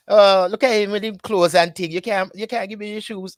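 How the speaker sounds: put-on high teasing Jamaican patois, jeering at the foreign boy; mocking Jamaican patois.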